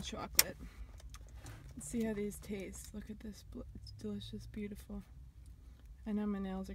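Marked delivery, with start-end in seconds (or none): 1.8-2.9 whispering. Real, not acted. Whispering to camera. 2.9-5.0 hushed. Real, not acted. Quiet close-up admiring.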